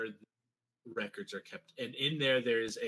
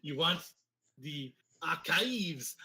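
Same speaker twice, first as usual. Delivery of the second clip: put-on German accent; 4 semitones higher than usual. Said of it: "little Hitler stash".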